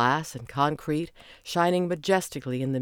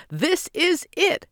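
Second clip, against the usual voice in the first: much higher, slow and bursting; even more excited; 5 dB louder, slower than usual. A narrator's reading. Simon repeating it, bursting with excitement. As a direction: squeal it out with delight. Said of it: "barely able to stand still".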